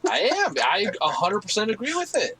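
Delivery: high, loud proud declaration; excited. Real, not acted. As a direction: proclaim it with gusto. Exaggerated proud agreement.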